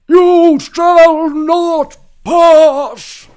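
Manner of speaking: put-on very high, loud, drawn-out old wizard bellow. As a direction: boom it like a wizard on the bridge. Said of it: "I'm almost really good at an old man voice".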